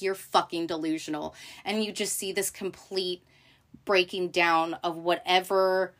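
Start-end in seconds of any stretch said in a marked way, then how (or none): none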